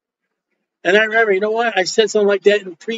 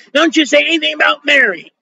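higher, warning tone; protectively scolding, raised voice; mock indignant woman; 7 semitones higher than usual. Woman defending Mary against the preacher, mock indignation.

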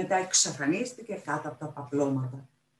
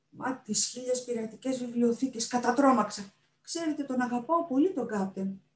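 startled pirate librarian, higher pitched; excitable pirate librarian; 7 semitones higher than usual. Acted. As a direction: fret over a dear friend.

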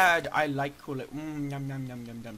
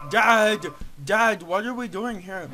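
put-on a little higher kid voice calling his dad loudly; kid son voice; 3 semitones higher, 7 dB louder than usual. Son calling his dad during the game, loud kid voice. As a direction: call out to Dad like an impatient kid.

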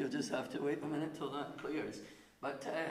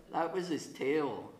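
normal talking voice

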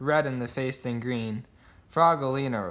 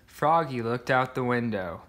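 ordinary delivery, nothing marked

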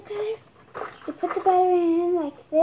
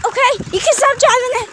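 loud, fast, high call; shouting; 8 semitones higher, 12 dB louder, faster than usual. Real, not acted. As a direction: yell across the yard to stop. Shouting at brother.

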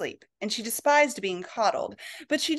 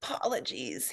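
much softer, barely voiced apology; mouthed apology; 2 semitones lower, 8 dB quieter than usual. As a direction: mouth the word almost silently. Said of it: "he mouthed".

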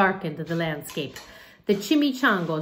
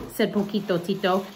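plain reading voice

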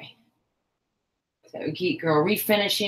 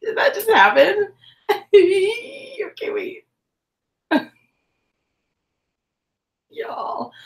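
much higher, laughing disbelief; stunned disbelief; 5 dB louder than usual. Real, not acted. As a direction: squeal it as it all falls apart. Crochet suddenly falls apart.

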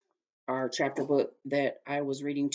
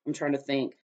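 usual speaking voice

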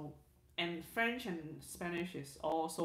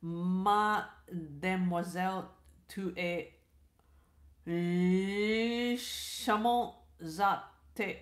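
slow, careful mock French; French attempt; 2 semitones lower, 4 dB louder, slower than usual. Shown as a demonstration. Streamer attempting French for chat, slow mock French. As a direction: try out French words very slowly. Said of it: "let me try".

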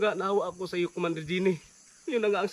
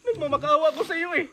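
higher, slow tearful plea; hammed-up begging; 8 semitones higher than usual. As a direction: drag out the begging like near tears. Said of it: "Nakakaiyak talaga".

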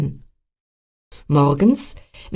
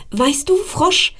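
higher, slow squirrel voice; 10 semitones higher, slower than usual. Acted. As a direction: call to the frog as the little squirrel. Squirrel Emil talks to frog.

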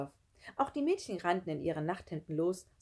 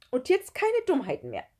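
high, loud strict warning; stern; 9 semitones higher, 7 dB louder than usual. A narrator's reading. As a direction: lay down the law, voice raised. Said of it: "in strengem Ton". (laut und streng)

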